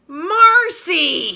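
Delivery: high, loud call of a name; alarmed shout of Marcy; crying out. Acted. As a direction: cry out her name in dismay. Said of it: "she cries".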